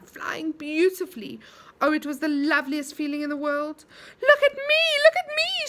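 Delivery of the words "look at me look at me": high, loud delighted calling; excited cry. A narrator's reading. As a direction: call out, thrilled to fly. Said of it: "she cried".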